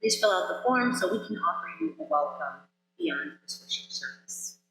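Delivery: ordinary delivery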